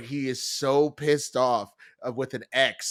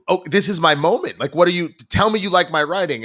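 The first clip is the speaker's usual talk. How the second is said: louder, mock-aggrieved outburst; indignant pleading; 3 semitones higher, 7 dB louder than usual. Writer to doubting friend, indignant and loud. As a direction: act out an affronted would-be author demanding support.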